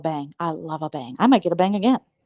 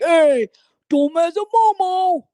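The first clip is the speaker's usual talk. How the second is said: mock tough guy; 10 semitones higher, 5 dB louder, slower than usual. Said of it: "He's like".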